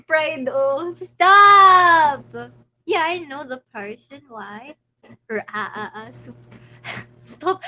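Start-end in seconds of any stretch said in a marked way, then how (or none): 0.9-2.3 shouted, drawn-out protest; loud stop. Real, not acted. 6.1-7.6 whispered laughing stop. Real, not acted.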